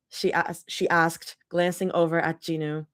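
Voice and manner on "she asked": usual speaking voice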